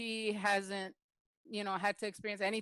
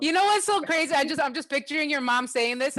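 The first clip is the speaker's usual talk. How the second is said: excited burst; 5 semitones higher, 10 dB louder than usual. Real, not acted. Host moved by the mom story.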